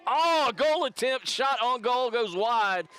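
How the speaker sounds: sudden high, loud burst as the shot flies; live goal-attempt call; excited call. Real, not acted. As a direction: call the shot with rising thrill.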